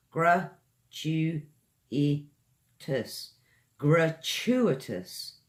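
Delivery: Gratuitous slowly in four syllables. Shown as a demonstration. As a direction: count out Gratuitous, slow. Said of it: "Four syllables".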